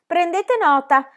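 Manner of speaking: slow, high echo, stretching nota; mock the order back, drawn out; cheeky echo. Pippi echoes the teacher mockingly.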